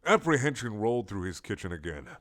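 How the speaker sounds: ordinary delivery, nothing marked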